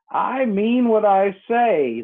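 slow, stiff and offended. A narrator's reading. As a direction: say it huffily, taking offence. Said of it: "in an offended tone".

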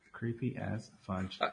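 slow, soft and low; low creepy murmur. Shown as a demonstration. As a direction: drag it out low and hushed.

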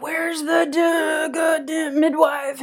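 high, slow, furious yell; yelling dad. Retold or imitated. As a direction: rage at the missing midwife. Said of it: "and he's yelling".

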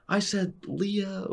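high, held-back mock yell; retold coach's scream. Coach screams at player on the line, mock yell. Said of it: "He's screaming at me".

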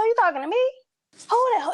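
high challenging question; confrontational. Retold or imitated. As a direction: square up to him.

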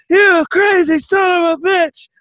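high, loud, elated holler; acted giddy shouting, stretched out; laughing yell. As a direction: holler it slowly at a friend in giddy delight. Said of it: "I just start laughing".

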